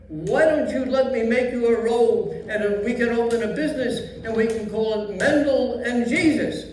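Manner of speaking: put-on old Yiddish grandpa accent; Yiddish old man voice.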